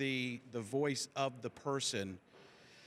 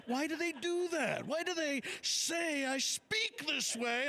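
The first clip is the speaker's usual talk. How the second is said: halting, much higher Shatner voice, slow; William Shatner impression; 9 semitones higher, slower than usual. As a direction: do Shatner's choppy dramatic delivery. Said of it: "He's like".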